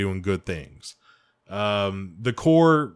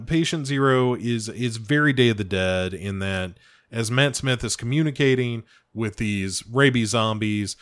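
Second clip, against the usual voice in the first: ordinary delivery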